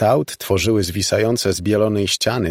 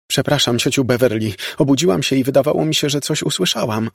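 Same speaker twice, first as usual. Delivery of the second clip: lekko odegrany, wyższy głos dziewczyny; tłumacz się cioci pospiesznie, wyżej; przepraszająca siostrzenica; 6 semitones higher, faster than usual. A narrator's reading. Voices apologetic Laura in story. (przepraszający głos siostrzenicy)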